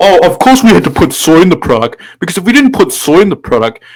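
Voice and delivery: high mocking voice; mocking the businessman. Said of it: "be like".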